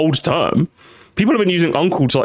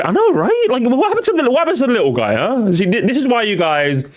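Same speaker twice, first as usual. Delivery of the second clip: much higher, hammed-up complaint; mock indignation; 8 semitones higher than usual. Streamer mocks big YouTubers to chat, high mock-indignant whine. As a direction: whine for the little guy.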